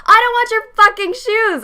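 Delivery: high, loud angry snap; furious. Retold or imitated. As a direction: spit it out in fury. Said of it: "I was so angry".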